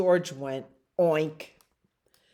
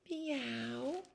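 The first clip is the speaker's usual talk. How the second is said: soft high kitten meow; a tiny breathy little mew; 7 semitones higher, 13 dB quieter than usual. Acted. Puppy George meowing softly instead of barking.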